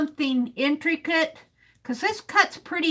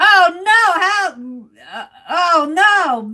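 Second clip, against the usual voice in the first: dismayed surprise; 4 semitones higher, 7 dB louder, slower than usual. Real, not acted. Reacts to long Dutch word.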